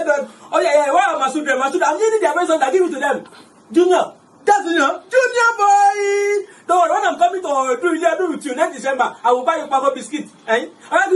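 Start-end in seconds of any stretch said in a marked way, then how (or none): 3.7-7.5 hammed-up sob with higher pitch; fake-cry into the phone; theatrical weepy call to Junior; mock crying.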